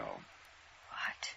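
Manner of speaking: breathless whispered what; stunned breathy what; shocked gasp. Acted. Shocked at murder news.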